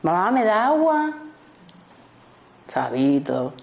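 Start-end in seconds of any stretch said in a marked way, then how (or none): 0.0-1.1 put-on higher, slow little-kid plea; child asking mom. Small child asks busy mother for water, higher plea. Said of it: "y llega el niño".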